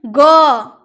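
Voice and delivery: "ग" loud as the letter sound guh; phonic sound. Shown as a demonstration. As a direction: sound out "ग" forcefully, not as jee. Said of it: "इसे हम जी नहीं पढ़ते".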